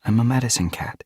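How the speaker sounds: quick, whispery self-reassurance; to herself. A narrator's reading. Frost Dawn reminds herself who she is, to herself. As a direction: murmur it quickly under the breath. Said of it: "she told herself".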